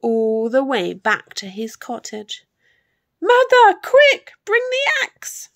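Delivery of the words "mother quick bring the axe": put-on very high, loud boy's yell; high-pitched panicked shouting; urgent shout. A narrator's reading. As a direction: yell frantically for help from your mother. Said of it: "he shouted".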